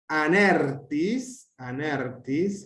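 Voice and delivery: anertis drawn out slowly as one word, tis leaning on aner; enclitic demonstration. Shown as a demonstration. Teacher models an enclitic for students, anertis slow and joined. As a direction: stretch anertis, accent on ner, tis attached. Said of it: "tis es enclítico".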